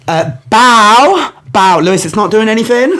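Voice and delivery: mum. Retold or imitated. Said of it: "my mum just starts going".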